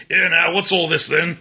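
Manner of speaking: clichéd, badly faked British accent; stock British accent. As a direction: put on a hammy old-fashioned British voice. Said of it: "when I do a British accent, it's always".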